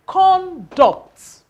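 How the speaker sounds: "conduct" said high and loud; model word. Shown as a demonstration. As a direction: present "conduct" to the class. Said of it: "in the words".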